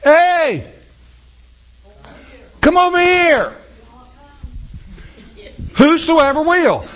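calling out high across a distance; Jesus calling out from the doorway; hollering. Retold or imitated. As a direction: call them over from far off. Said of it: "Jesus is hollering".

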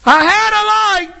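high desperate protest; pleading cry. Acted. Replays the shut-out plea.

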